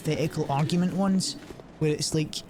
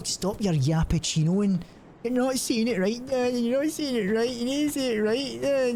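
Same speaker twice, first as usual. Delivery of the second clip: put-on much higher whiny kid voice correcting Dad; whiny child; 8 semitones higher than usual. Child correcting Dad's word, high whiny voice. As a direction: nag like a bratty kid.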